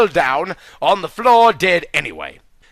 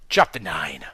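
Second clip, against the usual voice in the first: whisper; 8 dB quieter than usual. A narrator's reading. Reading chapter heading.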